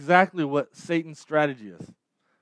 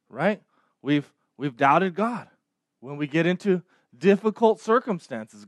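ordinary delivery

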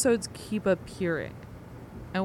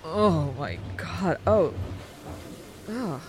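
nauseous. Acted. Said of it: "I think I'm gonna puke".

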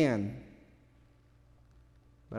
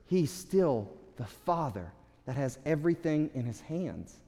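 hushed reassurance; 7 dB quieter than usual. Real, not acted.

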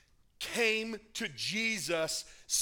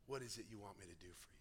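much softer, low, fast and tender; gentle question; 10 semitones lower, 17 dB quieter, much faster than usual. Acted. Jesus gently asking the blind beggar, tender. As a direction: ask it gently.

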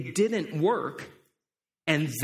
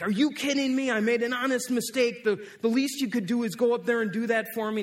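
louder, higher indignant outburst; really mad; 6 semitones higher, 4 dB louder than usual. Acted. Man pleading with usher over his mistaken offering, really mad. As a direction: protest angrily, pitch raised throughout. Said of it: "the guy got really mad".

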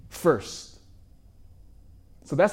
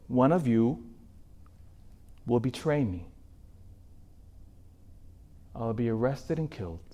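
much softer and lower, grave and hushed; solemn prophecy; 4 semitones lower, 9 dB quieter than usual. Acted. Jesus to his disciples, a hushed grave prophecy. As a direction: let it sink to a hush, foretelling death.

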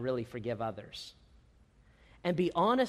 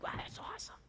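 breathy whispered wow, hushed and amazed; hushed excited whisper; whispered awe; 15 dB quieter than usual. Retold or imitated. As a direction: whisper it like a secret thrill. Quoted excited reply to congregant.

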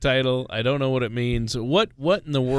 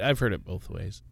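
usual speaking voice

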